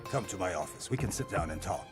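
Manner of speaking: low-pitched, steady coaxing; calm authority, voice kept low; calming, reassuring. Acted. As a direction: talk the agitated man down gently. Director soothes the agitated patient.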